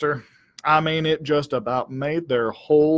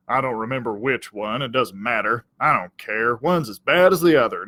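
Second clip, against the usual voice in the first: ordinary delivery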